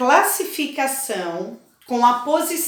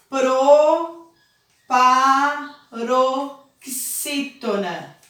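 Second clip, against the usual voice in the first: "proparoxítona" very slowly, each syllable drawn out; much slower than usual. Shown as a demonstration. Teacher spells out a new term slowly.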